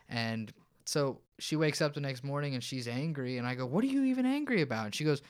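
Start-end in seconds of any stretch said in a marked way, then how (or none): 3.7-4.8 high, fast incredulous question; exasperated retort. Retold or imitated. Husband to his angry wife the next morning, retold high, fast disbelief. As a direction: fire it back in disbelief.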